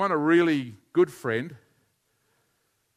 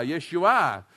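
usual speaking voice

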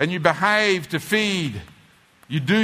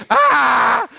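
loud, high mock cry; child's wail; 10 semitones higher, 6 dB louder than usual. Preacher mimics a child crying for what it wants, loud mock wail. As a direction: wail to get your way.

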